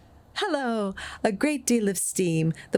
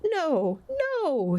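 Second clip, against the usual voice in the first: higher, pained refusal; distressed denial; 11 semitones higher than usual. A narrator's reading. Scrooge protesting to the ghost, distressed. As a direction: refuse to believe it. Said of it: "said Scrooge".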